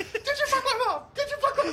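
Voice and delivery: high, loud retold scream with a laugh in it. Friend retelling Charlie attacking Santa, screaming. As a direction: shriek the question at Santa, laughing. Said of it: "he's just screaming".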